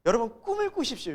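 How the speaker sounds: high, rousing exhortation; motivational preacher. Retold or imitated. Preachers urging young people to dream, imitated exhortation. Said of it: "젊은이들에게 설교자들이".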